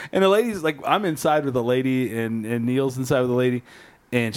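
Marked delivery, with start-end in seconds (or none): none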